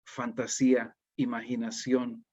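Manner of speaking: usual speaking voice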